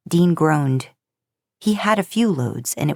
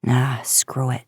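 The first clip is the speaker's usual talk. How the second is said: whispered aside; 5 semitones lower, slower than usual. A narrator's reading. Tired student giving up, inner thought.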